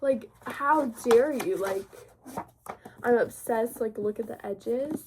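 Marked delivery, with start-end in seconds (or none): 0.0-1.9 slow, drawn-out, slightly louder how dare you; mock offense. Admiring the book to viewers, slow mock offense. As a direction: tease the book as if insulted.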